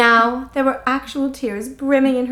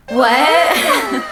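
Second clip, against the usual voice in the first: dazed what; 3 semitones higher, 3 dB louder than usual. Acted. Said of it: "She's like".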